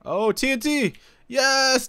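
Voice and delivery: cheering a great find; excited. Real, not acted. Finds TNT in raid chest.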